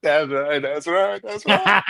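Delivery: rising into laughter; laughing, building up. Real, not acted. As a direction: cheer it up until you crack up. Hyped by the joke chain.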